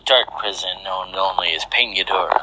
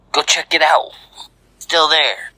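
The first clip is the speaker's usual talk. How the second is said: higher-pitched character voice, urgent order; nervous henchman giving an order; 8 semitones higher than usual. A narrator's reading. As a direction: toss out the order in a raised voice. First man ordering another in story.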